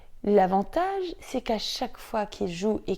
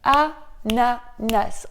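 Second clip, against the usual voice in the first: very slow, each syllable clapped apart; scanning syllables; 4 dB louder, much slower than usual. Shown as a demonstration. Teacher to parents, scanning a word syllable by syllable. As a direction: beat out A-NA-NAS in separate syllables. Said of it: "on va scander les syllabes".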